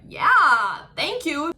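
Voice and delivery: loud, drawn-out pleased reply; delighted thanks. Retold or imitated. Her replying to people who love her accent, delighted. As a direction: act out lapping up a compliment.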